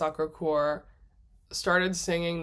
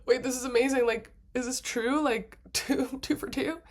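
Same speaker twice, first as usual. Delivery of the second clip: amazed; 6 semitones higher than usual. Retold or imitated. Retelling her amazed reaction on train.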